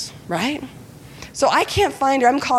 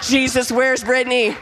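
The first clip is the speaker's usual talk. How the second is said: lightly imitating a fervent intercessor, raised a little higher; Lou Engle prayer; 4 semitones higher than usual. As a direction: pray like a kid doing a fiery prayer leader. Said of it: "turn into Lou Engle".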